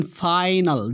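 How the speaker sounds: फाइनल drawn out on "faai"; long I as "ai". Shown as a demonstration. Teacher points out the odd word, slow "ai" in फाइनल. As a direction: stretch the "ai" in फाइनल, slowly. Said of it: "उच्चारण थोड़ा सा different".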